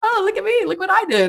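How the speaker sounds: very high-pitched, gleeful mock boasting; giddy show-off. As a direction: show off proudly as a giddy character. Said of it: "he's happy as hell".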